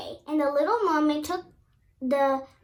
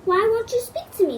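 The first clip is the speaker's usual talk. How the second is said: louder, higher puzzled plea; puzzled prince; puzzled question; 6 semitones higher, 7 dB louder, faster than usual. A narrator's reading. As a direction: ask it baffled and hurt. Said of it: "the prince asked, puzzled".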